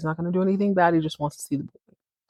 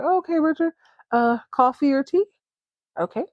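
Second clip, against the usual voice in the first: high, falsely friendly boss offering coffee; smarmy boss; 8 semitones higher than usual. Retold or imitated. Boss buttering up Richard before firing him, high and falsely sweet. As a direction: schmooze him before the bad news, pitched up. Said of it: "He's like".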